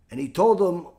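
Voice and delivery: usual speaking voice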